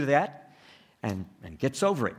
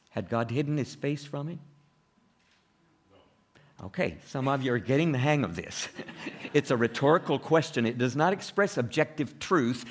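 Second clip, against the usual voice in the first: ordinary talk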